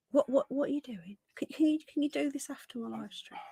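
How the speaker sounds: half-whispered interruption to her partner; hushed aside. Real, not acted. Aside to partner who walked in.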